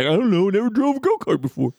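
high, airy fake-modest voice; mock innocent brag. Teasing his friend with a high, fake-modest reply. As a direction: shrug it off like a faux-humble champ. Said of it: "And you're just like".

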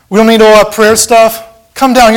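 taunting world yelling; mocking shout. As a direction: throw the taunt at him.

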